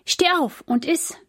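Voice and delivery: high-pitched wake-up prompt; urging angel. A narrator's reading. Angel waking Elia a second time, lightly urging. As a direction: lift the voice to rouse a sleeper. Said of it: "weckte ihn".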